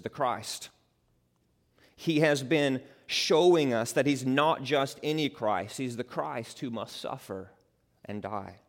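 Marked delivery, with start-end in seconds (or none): none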